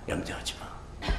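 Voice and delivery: soft, slow whisper; whispered reassurance. Acted. Voicing God comforting the worried believer, whispered. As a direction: breathe it gently to calm them.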